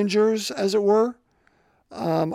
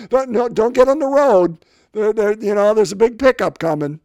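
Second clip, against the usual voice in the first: loud, higher calling out to followers; Jesus guiding blind men; 3 semitones higher, 6 dB louder than usual. Pretend. Jesus calls to the blind men trailing behind, loud and high. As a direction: call out so they can follow your voice. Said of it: "slowest walker in the world".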